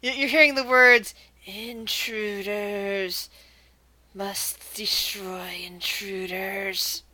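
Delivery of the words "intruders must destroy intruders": very slow, drawn-out raspy undead whisper; raspy mummy voice. Acted. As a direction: rasp it out slowly, stretching every word like an ancient mummy. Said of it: "you hear a raspy voice".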